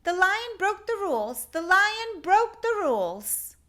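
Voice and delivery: high, loud tattling call; loud, high tattling call to the librarian. Acted. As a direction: shout the news as you hurry. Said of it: "he called as he walked".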